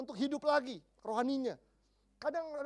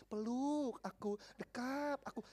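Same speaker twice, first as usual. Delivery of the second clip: slow, drawn-out mock pleading; acted craving for a hug; yearning; 5 dB quieter, much slower than usual. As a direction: stretch the words longingly. Acting out craving to be embraced.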